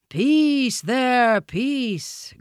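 high, drawn-out elephant call; gurgling elephant. A narrator's reading. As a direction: hush the crowd as a big elephant. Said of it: "gurgled Hati the wild elephant".

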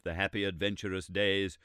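ordinary delivery

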